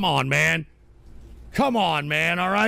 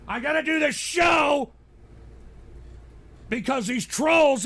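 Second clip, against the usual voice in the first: exasperated shout; 7 semitones higher than usual. Real, not acted. Streamer to chat about trolls, exasperated shout. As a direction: burst out at the trolls.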